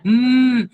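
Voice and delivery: loud held humming; loud demo hum; letter sound hum. Shown as a demonstration. Teacher voicing the letter sound.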